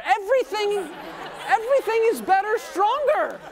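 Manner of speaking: rally the congregation, full voice; preaching loud. Real, not acted. Pastor urging the crowd to answer, loud and high.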